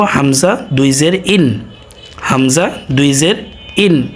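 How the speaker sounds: slow, careful Arabic drill, stretching ইন; drawn-out recitation. Shown as a demonstration. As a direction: spell out হামজা দুই জের ইন slowly for students. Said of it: "তাহলে আমরা এটাকে কিভাবে পড়বো?". (ধীরে টেনে আরবি উচ্চারণ)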